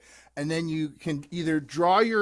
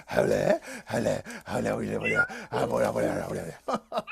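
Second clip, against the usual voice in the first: puppy voice; 6 semitones lower than usual. Acted. Puppy puppet voice.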